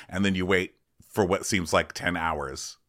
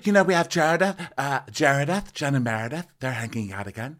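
high, lively Lisa voice; mock Lisa; 8 semitones higher than usual. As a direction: gossip like a delighted Lisa. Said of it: "And Lisa's like".

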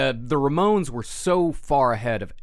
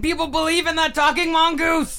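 much higher, louder fake rage; mock angry; 6 dB louder than usual. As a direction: shout like you're furious. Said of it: "You gotta get angry".

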